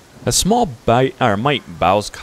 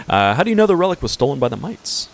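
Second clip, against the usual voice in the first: ordinary talk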